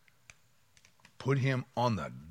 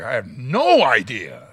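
slow, louder, feigned innocence; playing dumb; 7 dB louder, slower than usual. Driver pulled over by police, slow fake innocence. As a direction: stretch it out all innocent. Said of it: "we act always like".

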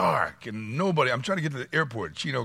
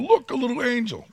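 put-on much higher, cheery little kid voice; mock child delight; 13 semitones higher, 3 dB louder than usual. Kid showing off a snow angel, high and delighted. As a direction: squeal it like a proud child.

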